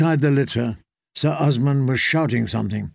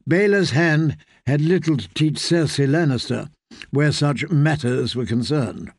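plain reading voice

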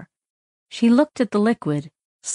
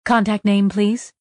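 automated voice. Acted. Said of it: "an automated voice said".

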